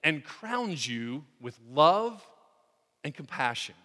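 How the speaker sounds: preacher reciting Psalm 103 aloud, fervent and loud; fervent scripture reading. Acted. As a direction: proclaim the verse to the congregation.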